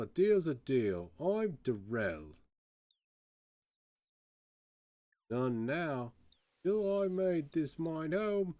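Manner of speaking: lilting, swooping pirate voice; salty Cockney privateer, pitch leaping up. Acted. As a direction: play an old Cockney sailor telling his past. Prisoner introduces himself as ex-privateer.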